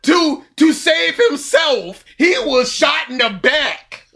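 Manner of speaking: high mock shout, laughing; hammed-up gloating Voldemort. Fan imitating Voldemort gloating to Hogwarts. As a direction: crow over the body to the crowd. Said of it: "where he just goes".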